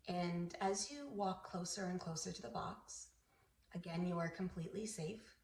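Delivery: soft, soothing meditation voice; guided meditation. Acted. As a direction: ease the listener gently into relaxation, softly.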